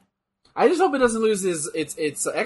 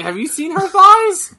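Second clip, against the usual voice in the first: higher, louder and slow, gushing; gushing excited; 12 semitones higher, 10 dB louder, slower than usual. Real, not acted. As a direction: rave about her with delight. Gushing over character.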